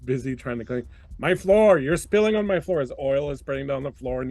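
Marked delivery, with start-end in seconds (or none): 1.2-2.8 higher, louder fretting robot voice; fussy cleaning robot. Acted. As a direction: fret over the mess like a neat-freak bot. Cleaning bot frets over floor.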